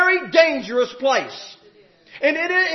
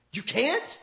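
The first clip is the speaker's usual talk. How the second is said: quiet rising challenge, drawn out a little; preacher quietly challenging the outcry; softer incredulous echo; 3 semitones lower, 9 dB quieter than usual. Retold or imitated. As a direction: repeat it softly, letting it hang. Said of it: "I'm standing there saying".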